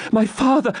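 very high, distressed plea; desperate high-pitched heroine; anguished cry. A narrator's reading. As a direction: cry out in fear for a parent. Rebecca fears for her father.

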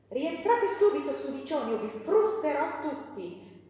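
put-on a furious father yelling at his kids; angry shout. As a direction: roar the order at the dirty children. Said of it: "egli gridò".

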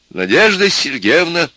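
slow, loud, high dictation; dictating. A narrator's reading. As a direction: call it out for someone writing. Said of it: "стал диктовать профессор".

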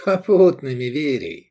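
high, chirpy quip sinking at the end; giggly. A narrator's reading. Little man joking with the owner, giggly. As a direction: toss back the joke with a titter. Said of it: "хихикнул Севий".

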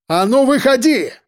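high commanding shout. A narrator's reading. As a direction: yell it out into the dark. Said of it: "крикнул я".

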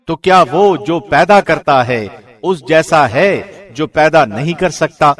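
ordinary talk, nothing marked

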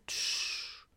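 unvoiced hush; hushing sound; shushing. A narrator's reading. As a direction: shh to silence him. Grandfather hushes.

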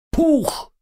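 very high squeaky pop; eager bug squeak; cockroach voice. Acted. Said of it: "утвердительно махнул усами членистоногий".

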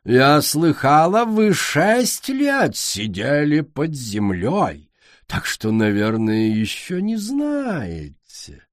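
slow, grand and condescending; haughty queen. Acted. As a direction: talk down like a grande dame.

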